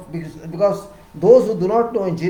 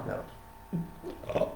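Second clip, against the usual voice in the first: whispered never; 13 dB quieter than usual. Shown as a demonstration. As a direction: drop the last never to a bare whisper. Third never dropped to a whisper.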